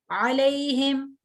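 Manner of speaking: अलैहिम slowly, with a clear throaty ain. Shown as a demonstration. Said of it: "आइन है".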